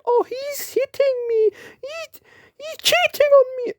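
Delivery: very high whiny tattling voice, near crying; tattletale. Retold or imitated. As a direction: squeal like a kid telling on someone. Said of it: "personal people that will go up to them and say".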